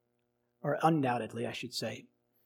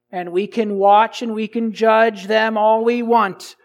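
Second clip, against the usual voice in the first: ordinary talk, nothing marked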